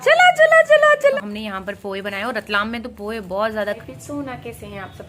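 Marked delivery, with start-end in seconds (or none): 0.0-1.2 loud, high call across the room. Real, not acted. Vlogger calling her staff over, loud and high. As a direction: call everyone over at full voice.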